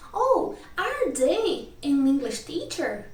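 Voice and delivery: slow, swooping question tune on "Oh, are they an English teacher"; question intonation. Shown as a demonstration. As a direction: ask "are they an English teacher" slowly, pitch rising and falling widely. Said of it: "a minha entonação muda".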